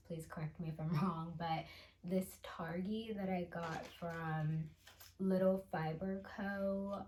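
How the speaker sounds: normal talking voice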